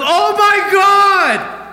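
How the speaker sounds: long, high, loud cry of terror; acted full-voice freak-out, drawn out; panicked shout. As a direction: scream it as if a bear is charging. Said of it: "he's freaking out".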